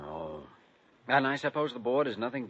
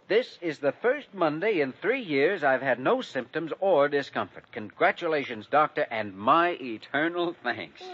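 raised, projected telegram reading; triumphant reading; 6 semitones higher, 4 dB louder than usual. Acted. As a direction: read it out proudly to the room. Reads out triumphant good-news telegram.

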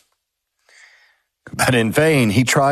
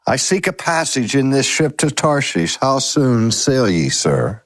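usual speaking voice

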